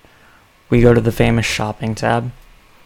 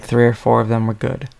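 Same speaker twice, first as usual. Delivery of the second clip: usual speaking voice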